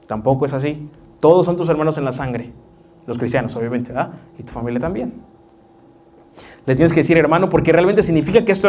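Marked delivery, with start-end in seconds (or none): none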